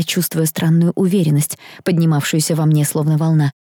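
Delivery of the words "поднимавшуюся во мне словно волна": ordinary delivery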